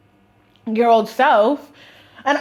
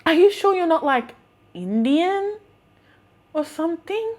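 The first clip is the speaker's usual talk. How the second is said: higher-pitched imitation of a puzzled customer; doubting customer; 6 semitones higher than usual. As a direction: question it with mock doubt, rising at the end. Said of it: "And he was like".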